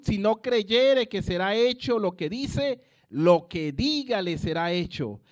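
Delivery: loud, driving preacher's proclamation; fervent loud sermon voice; full-force preaching. Acted. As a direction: preach it out to the congregation.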